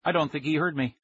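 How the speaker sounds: ordinary delivery, nothing marked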